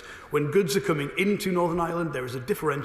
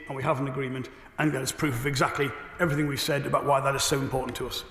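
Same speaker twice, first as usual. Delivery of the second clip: ordinary talk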